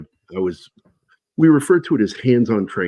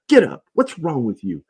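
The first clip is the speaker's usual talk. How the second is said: retold drill sergeant yell; 7 semitones higher than usual. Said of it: "I hear him yelling at Jones".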